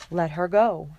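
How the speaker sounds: usual speaking voice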